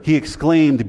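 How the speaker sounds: ordinary talk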